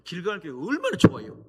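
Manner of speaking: loud, high burst of praise for the church; excited praise. Real, not acted. Guest preacher praising the host church: loud, high excited cheer.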